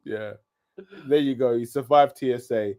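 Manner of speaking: normal talking voice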